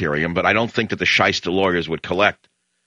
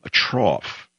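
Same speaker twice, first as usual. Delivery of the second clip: "trough" leaned on, said with mock weight; stress on trough; 3 semitones higher than usual. Shown as a demonstration. Host stressing "trough" to mock the article's wording. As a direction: hit the word "trough". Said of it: "the emphasis is on the word".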